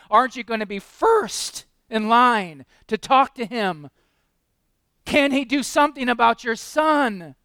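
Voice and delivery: loud, rising preacher's appeal; full-force pulpit plea; impassioned preaching. Acted. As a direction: press the congregation harder and harder. Preacher building emotional appeal.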